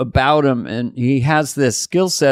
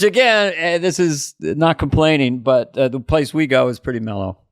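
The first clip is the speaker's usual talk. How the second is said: normal talking voice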